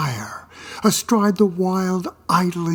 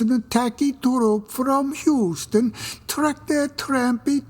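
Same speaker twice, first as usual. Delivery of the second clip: raised pitch all through; high voice; 8 semitones higher than usual. Shown as a demonstration. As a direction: read it up high and bright. Pitched-up twister run.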